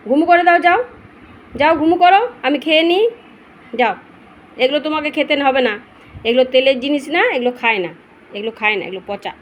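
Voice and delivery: high loud shoo; shooing the dog. Real, not acted. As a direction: shoo the puppy away, loudly. Shooing dog, food is bad.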